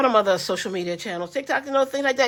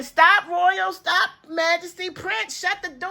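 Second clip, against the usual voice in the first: high, mock yelling at the grandkids; mock scream at kids; 8 semitones higher, slower than usual. Grandma mock-yelling at her grandkids, high and playful. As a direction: call them out high, half-playful. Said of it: "Y'all don't hear me screaming".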